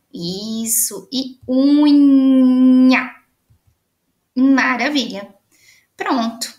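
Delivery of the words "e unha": unha said very slowly and louder, leaning on nha; a long, emphatic unha; drawn-out ending. Shown as a demonstration. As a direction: stretch unha to show the ending. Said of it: "todas que tiverem N H A".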